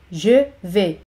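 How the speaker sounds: "Je", short pause, "vais"; teacher's spaced-out "Je ... vais"; word by word. Shown as a demonstration. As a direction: separate "Je" from "vais" for learners. Teacher models phrase, then separates it.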